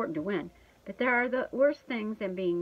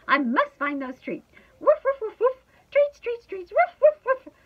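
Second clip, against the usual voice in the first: loud, high, eager dog barking; building to yelped words and woofs; frantic excitement; 13 semitones higher, 9 dB louder than usual. A narrator's reading. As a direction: bark urgently for treats. Dog frantic to find treats.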